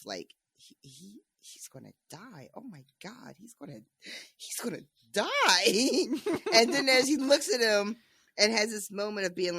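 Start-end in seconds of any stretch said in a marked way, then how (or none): 0.5-5.3 very soft, halting, stunned mock gasp; hushed disbelief. Tyrion watching Joffrey choke, hushed disbelief. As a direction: barely voice it, stammering in acted disbelief. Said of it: "Tyrion is watching in disbelief".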